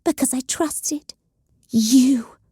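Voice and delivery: slow, high, venomous hiss; a mild hiss of betrayal. Acted. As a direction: spit the words at him slowly. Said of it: "Evelyn hissed".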